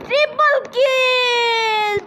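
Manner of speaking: very slow, drawn-out announcer call; esports caster. Pretend. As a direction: stretch it like a hype caster. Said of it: "caster call repeated". (ধারাভাষ্যকারের মতো টেনে বলা)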